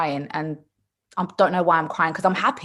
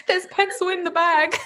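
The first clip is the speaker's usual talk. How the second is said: high-pitched imitation plea through laughter; comically high retold cry; mock plea; 11 semitones higher than usual. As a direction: pipe it up high, giggling. Retold cry about rubbed-off eyebrow.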